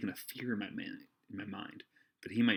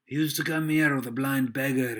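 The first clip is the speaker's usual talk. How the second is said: raised, rolling seaman's voice; loud recollection; 5 semitones higher, 10 dB louder than usual. Acted. Silver remembers the blind beggar, loud seaman voice. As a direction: recall it loudly for the room. Silver recalls aloud, acted.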